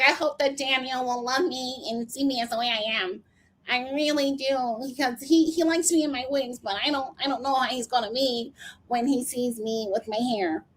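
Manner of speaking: soft, put-on pleading voice; imitated tearful confessional. Recapper mimicking a woman's wig confession, mock vulnerable. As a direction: mimic an insecure woman confiding to camera. Voicing Lisa's anxious confessional.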